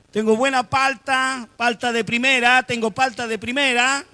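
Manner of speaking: loud, high, singsong hawker's pitch; shouted vendor patter. Acted. Calling customers to the car boot, loud vendor's cry. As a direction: cry your wares to the street. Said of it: "Y estaba gritando".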